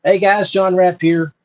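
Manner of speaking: ordinary delivery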